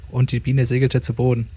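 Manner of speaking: ordinary delivery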